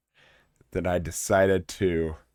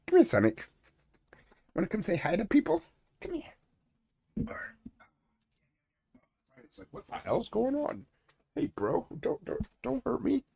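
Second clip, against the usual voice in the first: much higher coaxing puppy voice; baby talk to dog; 4 dB quieter than usual. Acted. Host coaxing and voicing his dog Sonic, high. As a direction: coo at the dog.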